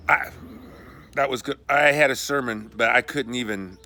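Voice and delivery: real angry outburst. Real, not acted. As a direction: let the anger burst out. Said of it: "I was so angry".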